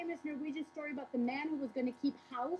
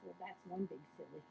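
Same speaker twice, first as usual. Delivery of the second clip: soft, low muttered verdict; dry understated aside; 7 semitones lower, 11 dB quieter than usual. Acted. Sweetheart watches the farmer's mess, drily muttering. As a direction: shake your head at it. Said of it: "shakes his head and goes".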